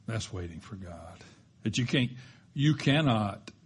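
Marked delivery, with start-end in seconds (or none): 0.1-1.2 soft, low echo of the line; quiet repeat. Shown as a demonstration. Preacher repeating the point, hushed. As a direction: say it again, just as hushed.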